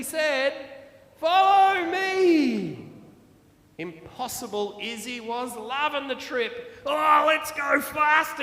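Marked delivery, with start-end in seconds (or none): 1.2-2.9 slow, loud, drawn-out call; slow called-out command. Acted. Mister T leads the cars. 6.8-8.4 loud, high, thrilled call; eager shout. Acted. Izzy zooms ahead excitedly.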